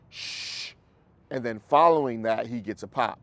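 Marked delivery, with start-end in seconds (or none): 0.1-0.7 soft drawn-out shh with no voice; shushing sound. Shown as a demonstration. Trainer hushing a howling dog with a soft shh. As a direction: hush the dog with a long shh. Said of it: "he hears".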